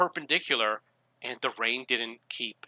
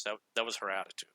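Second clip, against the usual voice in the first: ordinary talk, nothing marked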